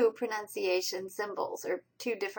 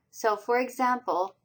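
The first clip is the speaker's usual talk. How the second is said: normal talking voice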